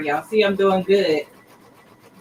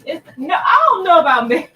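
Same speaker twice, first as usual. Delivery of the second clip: excited protest; 11 semitones higher, 6 dB louder than usual. Real, not acted. Reacting to chat, exclaiming.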